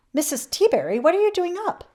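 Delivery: put-on old man surprised to see his neighbour up late; surprised question. A narrator's reading. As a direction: sound startled to find her awake at night. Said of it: "asked Mister Putter".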